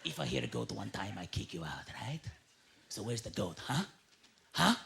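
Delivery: low, soft menacing warning; gruff landlord threat. Retold or imitated. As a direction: lean in and threaten quietly.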